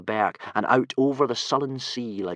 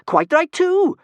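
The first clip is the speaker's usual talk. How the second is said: put-on much higher, louder spirited girl; Kamikaze; 12 dB louder, slower than usual. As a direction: chime in like a fearless tomboy. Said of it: "said Kamikaze".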